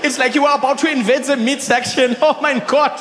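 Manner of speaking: put-on high German-accented teasing, laughing; amused German friend. Friend mocking the way he ordered, laughing. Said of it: "He's like".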